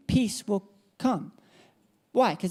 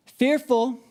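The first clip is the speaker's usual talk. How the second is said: fearful said louder and higher; Fearful called out firmly; emphatic word; 4 semitones higher, 3 dB louder than usual. Shown as a demonstration. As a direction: lift and push the word Fearful.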